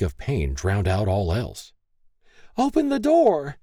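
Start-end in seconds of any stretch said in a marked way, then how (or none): 2.6-3.6 very high-pitched urgent cry; desperate yell. A narrator's reading. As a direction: yell it in alarm at a shut door. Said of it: "she yelled".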